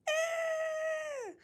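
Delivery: long, very high, loud shriek; mock scream. Healing girl watching him get stabbed, hammed-up high scream. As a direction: imitate a girl's piercing scream of horror.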